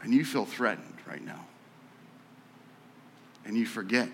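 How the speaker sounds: calm friend calming an angry classmate; tender friend. Retold or imitated. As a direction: speak it quietly with love. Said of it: "He goes".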